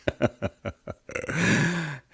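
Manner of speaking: a bright, high burst of laughter. Real, not acted. Host cracking up at his own wordplay, high laugh.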